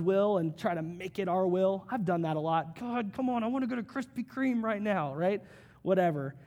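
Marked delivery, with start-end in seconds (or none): 2.8-5.1 whiny pleading. Retold or imitated. Twisting God's will for donuts.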